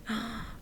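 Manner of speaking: soft breathy gasp of surprise; acted gasp. She hears he really likes her, acted soft gasp. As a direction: re-enact a small, quiet gasp.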